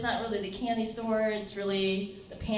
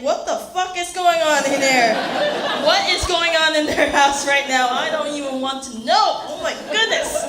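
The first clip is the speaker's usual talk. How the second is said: loud, higher-pitched incredulous rant; baffled outburst; 4 semitones higher, 9 dB louder than usual. Retold or imitated. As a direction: blurt it out, loud and appalled. Acted shocked reaction to odd order.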